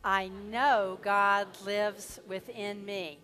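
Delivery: slow, stretched-out affirmation chant; slow group affirmation. Acted. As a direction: lead the congregation slowly, every word drawn out. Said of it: "let's affirm that together".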